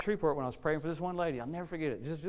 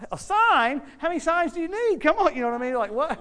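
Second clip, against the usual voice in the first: loud, high, exasperated preaching; incredulous shout; 12 semitones higher, 11 dB louder than usual. Real, not acted. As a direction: shout it out in disbelief. Preacher mocking demand for signs.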